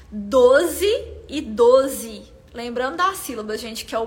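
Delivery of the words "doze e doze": very slow, each syllable spaced out; "Doze e doze" drawn out syllable by syllable as if counting; counting syllables slowly. Shown as a demonstration. Teacher spelling out the price's syllables to the live audience, very slow and deliberate. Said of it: "Nós temos quatro sílabas".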